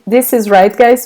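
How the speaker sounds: ordinary delivery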